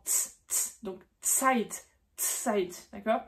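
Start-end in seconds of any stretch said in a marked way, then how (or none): 0.0-0.8 whispered tz, tz; ts sound. Shown as a demonstration. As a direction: hiss a sharp tz. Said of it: "comme avec un T devant".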